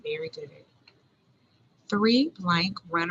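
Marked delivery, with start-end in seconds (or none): 0.0-0.5 soft praise. Real, not acted. Teacher praises the class.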